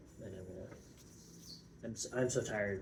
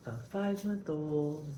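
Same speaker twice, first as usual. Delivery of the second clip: put-on slow, higher cooing baby talk; 6 semitones higher, 4 dB louder than usual. Shown as a demonstration. Tired parent showing her airplane baby talk, slow and higher. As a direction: coo it like to an infant. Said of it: "I spent a lot of time talking like this".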